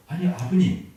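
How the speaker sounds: 높고 크게 놀라서 부르기; 깜짝 놀란 아들의 외침. Acted. Son startled by father's words. (높고 크게 놀란 목소리)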